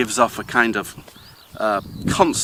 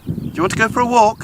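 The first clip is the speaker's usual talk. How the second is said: much higher and fast, sing-song rising question; excited dog question; 13 semitones higher, 5 dB louder, faster than usual. Shown as a demonstration. Owner asking the dog about a walk, high excited rise. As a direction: pitch it way up and hurry it, like teasing a dog with a walk. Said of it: "they can distinguish a rising intonation of a question".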